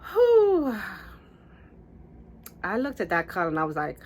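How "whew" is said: high, loud whew; stunned exhale, acted out; big whew. Reliving sight in mirror.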